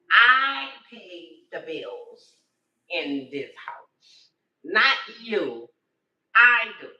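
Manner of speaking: slow and loud, every word hit hard; emphatic insistence. Real, not acted. Grandmother telling her grown children whose house it is, slow and loud. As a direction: lay down the law in your own house.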